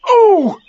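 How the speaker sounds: loud, very high squeal; pained ooh. A narrator's reading. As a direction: squeal while being pulled. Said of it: "Pooh only said".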